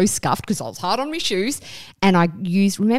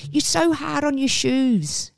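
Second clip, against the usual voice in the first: higher, slower imitation of mum; mum's nagging; 8 semitones higher, slower than usual. Imitating her mum nagging about scuffed shoes, slow and high. And she'd always go.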